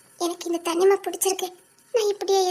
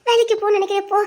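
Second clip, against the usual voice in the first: normal talking voice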